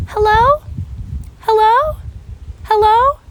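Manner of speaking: high, loud, rising call; kid calling out. Retold or imitated. Kid calling into bathroom stall, high loud calling. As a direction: call out questioningly in an imitated kid's voice. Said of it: "kid comes in and is going".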